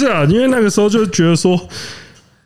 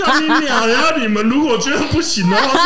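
laughing; 6 semitones higher than usual. Retold or imitated. Acting out her own outburst.

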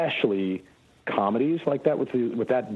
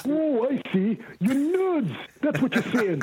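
mock offense; 13 semitones higher than usual. Jokey mock-offended reply to "fans.

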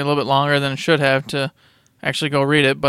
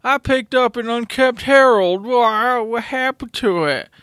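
put-on confused drunk; 7 semitones higher than usual. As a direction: whine like a drunk gamer. Acting drunk gamers bickering.

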